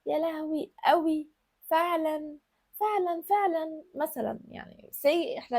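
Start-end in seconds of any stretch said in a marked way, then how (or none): 0.1-3.8 higher, slow, sing-song pampered tone; coquettish signature words. Shown as a demonstration. Coach demos a woman's pampered catchphrases. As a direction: coo the pet words, drawing them out. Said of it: "خلي فيه كلمات تبقى انت مميزة بيها".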